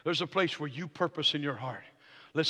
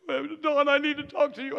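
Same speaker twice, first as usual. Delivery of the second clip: higher-pitched whiny plea; mock whining; 9 semitones higher, 3 dB louder than usual. Friend coming into the pastor's office for help, imitated whining. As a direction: imitate a man feeling sorry for himself. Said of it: "he's kinda whining".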